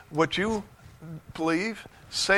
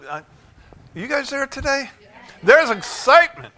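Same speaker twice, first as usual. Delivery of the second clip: ordinary talk, nothing marked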